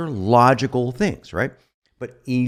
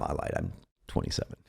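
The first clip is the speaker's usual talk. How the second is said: whispered confession; 12 dB quieter, faster than usual. Real, not acted. Confessing his real age.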